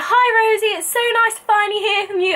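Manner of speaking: high, bright-voiced friendly caller, quick; a sunny phone greeting; lightly voiced cheerful recruiter; cheery recruiter.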